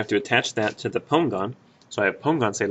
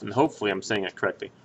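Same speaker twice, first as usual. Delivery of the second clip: ordinary talk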